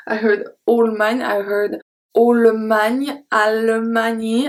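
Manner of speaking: Allemagne said ol-man, rising; imitating mispronunciations. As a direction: mimic learners' odd Allemagne. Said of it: "so many things".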